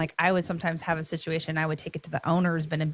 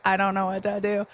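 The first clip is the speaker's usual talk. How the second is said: robot voice; 3 semitones higher than usual. Pretend. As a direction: play a lost, clunky robot. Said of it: "I'm speaking in my robot voice".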